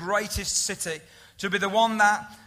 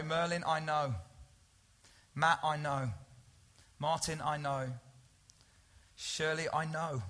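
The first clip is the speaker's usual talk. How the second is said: soft and low, a pause before each name; gentle personal reassurance; 4 semitones lower, 7 dB quieter than usual. Acted. Jesus speaking to each member of the congregation, tender and soft.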